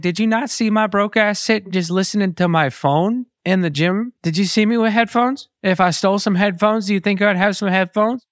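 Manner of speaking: high-pitched, incredulous protest; indignant self-defence. Retold or imitated. Retold self-defence against theft accusation.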